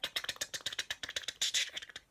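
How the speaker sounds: quick clicking mouth noises; camera click noises. Acted. Dashi snapping photos, clicking camera sounds. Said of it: "Dashi was like".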